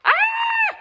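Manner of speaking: high, loud startled yell; Granny yelling at the wolf in fright. Acted. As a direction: shriek in fright. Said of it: "yelled Granny".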